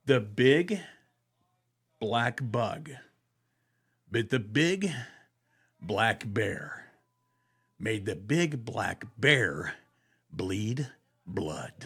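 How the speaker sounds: phrase by phrase, slowly, fading at the end; slow broken drill. Shown as a demonstration. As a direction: chop it into slow pieces and let it die away. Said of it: "These are vocal exercises".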